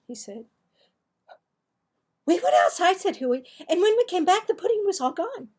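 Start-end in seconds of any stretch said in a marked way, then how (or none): 2.3-5.5 put-on higher, louder boy blurting an excuse; scared boy. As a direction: rush out a nervous fib. Frightened boy makes excuses.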